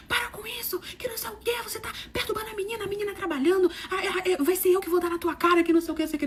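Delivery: high, strained retold scolding; imitated quarrelling man. Man stepping into a metro quarrel, high agitated scolding. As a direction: act out someone butting into a fight.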